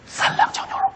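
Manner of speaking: hushed customer's order; furtive, breath-only voice; secretive whisper. Pretend. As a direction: whisper it like contraband. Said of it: "小声嘀咕着说".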